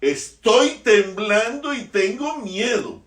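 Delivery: loud, high, slow cry of terror; trembling fear. Acted. Moses quoted before God, crying out in fear.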